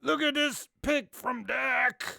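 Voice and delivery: slow, rough gravelly delivery. Pretend. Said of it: "with the most gravelly voice you can".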